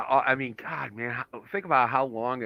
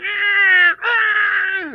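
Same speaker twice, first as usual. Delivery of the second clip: imitated dying cow cry; 8 dB louder than usual. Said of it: "you gotta hear it going".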